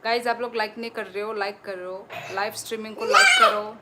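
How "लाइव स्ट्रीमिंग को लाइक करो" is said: much higher and louder, fast, laughing through it; laughing plea. Real, not acted. Host laughingly urging live viewers to like the stream. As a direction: cheerfully shout the request while laughing.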